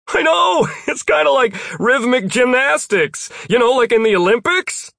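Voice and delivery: loud, high, enthusiastic yell; high-pitched, gleeful holler; excited shouting. A narrator's reading. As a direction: yell it down, thrilled with the trick. Jeff shouts back while flying.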